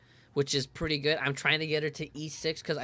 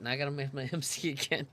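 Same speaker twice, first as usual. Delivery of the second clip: normal talking voice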